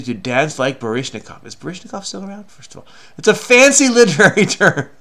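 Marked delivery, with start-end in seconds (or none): 3.2-4.9 laughing, louder and higher; high, loud, laughing delivery; amused mockery. Real, not acted. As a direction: crack up while mocking how highbrow it is. Mocking the fancy word, laughing.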